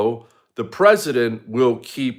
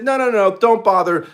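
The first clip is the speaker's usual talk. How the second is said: much higher, dismissive mock refusal; 10 semitones higher, 4 dB louder than usual. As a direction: brush him off in a sneering caricature. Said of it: "They said".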